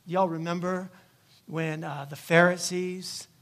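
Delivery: ordinary delivery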